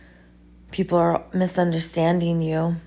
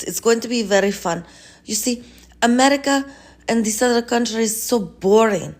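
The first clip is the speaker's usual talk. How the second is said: put-on Putin with a Russian accent; 3 semitones higher than usual. Putin pitches Mother Russia to the healer, eager mock accent. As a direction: sell the Russian dream with a hammed-up accent.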